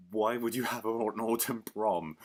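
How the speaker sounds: loud, slow, baffled demand; incredulous. Real, not acted. Vlogger questioning the idea of an autumn prom, loudly incredulous. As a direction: ask it loudly and slowly, in disbelief. Baffled rant at plot detail.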